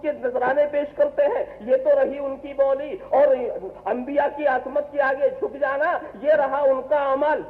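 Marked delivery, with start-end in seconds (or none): none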